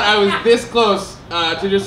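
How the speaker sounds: normal talking voice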